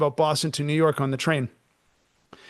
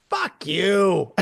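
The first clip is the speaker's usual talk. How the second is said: mock angry curse; retold snarl. Smoker on the bus cursing at him, mock angry. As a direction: snap it back like the smoker. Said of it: "And she goes".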